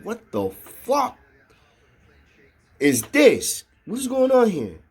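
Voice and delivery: high, drawn-out baffled reaction; exaggerated retold astonishment; bewildered disbelief. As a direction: act out total confusion, stretching the opening words. Acts out his bewildered inner reaction.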